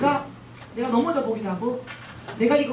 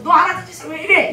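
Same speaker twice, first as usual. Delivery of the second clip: loud, sharp mock scolding; angry scolding; 8 semitones higher, 10 dB louder than usual. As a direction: snap at the kid like an angry parent. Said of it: "화를 확 내시면서". (화난 엄마처럼 크게 꾸짖기)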